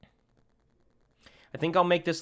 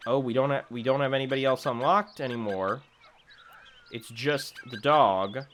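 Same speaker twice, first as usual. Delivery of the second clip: ordinary talk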